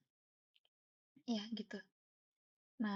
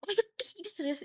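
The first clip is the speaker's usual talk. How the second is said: retold amazement; 8 semitones higher than usual. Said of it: "Saat itu aku bener-bener yang kayak".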